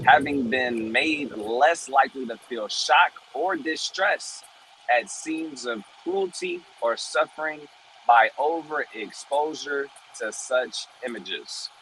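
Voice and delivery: normal talking voice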